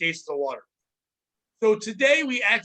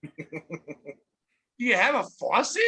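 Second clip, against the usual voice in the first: sarcastic; much slower than usual. Retold or imitated. Sarcastic retort to customer.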